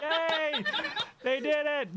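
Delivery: high, delighted laughing cheer. Real, not acted. Cheering a successful spell roll.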